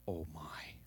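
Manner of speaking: low, near-whispered acted exclamation; hushed awe. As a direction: breathe it out in wonder, very softly. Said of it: "Simon Peter answered".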